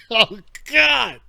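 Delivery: ordinary delivery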